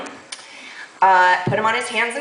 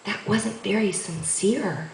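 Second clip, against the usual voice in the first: slow, softer reprimand; 6 dB quieter, slower than usual. Retold or imitated. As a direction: imitate a quiet, measured scolding. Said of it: "I say very softly".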